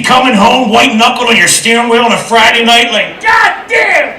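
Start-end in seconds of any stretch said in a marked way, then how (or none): 3.2-4.1 mock excited high yell; eager newlywed. New husband racing home to his bride, mock excited high yell. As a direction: whoop it like you can't wait to get home.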